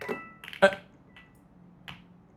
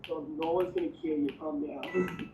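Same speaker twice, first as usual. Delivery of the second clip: high soft put-on voice; 5 semitones higher, 11 dB quieter than usual. Voicing someone reassuring.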